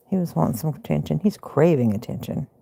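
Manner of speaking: usual speaking voice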